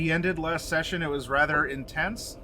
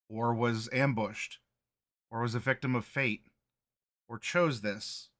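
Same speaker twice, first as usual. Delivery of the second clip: story narration. A narrator's reading. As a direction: set the scene like a storyteller. Recap narration of last session.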